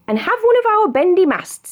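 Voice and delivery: lightly voiced high friend, offering; high character voice. Gizzy offering a bendy mast, lightly high. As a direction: pitch it up as a kindly friend.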